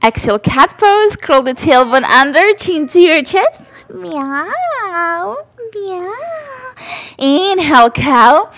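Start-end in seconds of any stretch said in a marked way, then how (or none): 3.9-6.1 high soft cat meow; playful meow. Shown as a demonstration. Mother meows to baby in cat pose, high and soft. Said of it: "Maybe make some sound".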